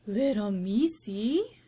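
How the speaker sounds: slow, gentle coo; tender. Acted. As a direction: Mig cooing to the mouse. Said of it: "she said".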